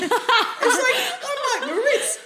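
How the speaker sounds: high, very slow, laughing through the name; laughing impression. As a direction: mimic him grandly while cracking up. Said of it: "He's like".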